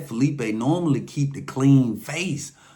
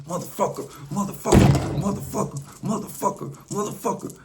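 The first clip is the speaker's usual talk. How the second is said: furious attack; 8 semitones higher than usual. Retold or imitated. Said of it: "Felipe just went to hitting him".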